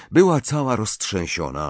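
plain reading voice

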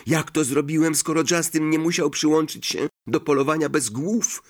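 Harry, indignant. Acted. Voices Harry demanding an explanation.

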